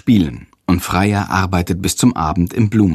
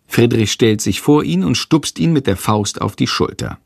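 ordinary delivery, nothing marked

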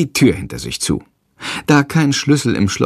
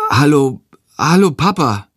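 higher, taken-aback greeting; flabbergasted; 5 semitones higher, 3 dB louder than usual. A narrator's reading. Jendrik meets his father and sees the baby, flabbergasted. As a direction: stumble into the hello, stunned. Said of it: "sagt er verdattert".

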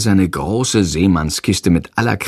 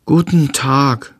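polite; 4 semitones higher, slower than usual. A narrator's reading. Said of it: "sagt er höflich".

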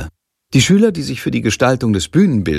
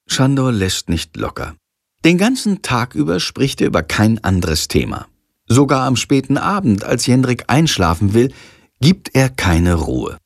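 ordinary delivery

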